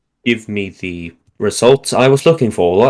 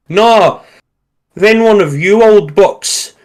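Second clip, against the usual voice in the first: higher-pitched Cockney trooper voice; Cockney accent; 9 semitones higher, 5 dB louder, slower than usual. A narrator's reading. Cockney trooper claiming the bet from his mates, Cockney accent. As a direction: put on a cheeky London Cockney. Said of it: "one of our real Cockney troopers".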